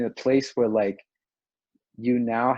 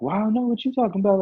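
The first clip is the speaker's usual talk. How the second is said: mock knowing; 11 semitones higher, 4 dB louder, faster than usual. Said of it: "just been like".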